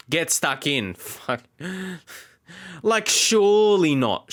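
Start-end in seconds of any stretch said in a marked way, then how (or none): 2.8-4.3 disbelieving groan. Real, not acted. Disbelief at conceding again.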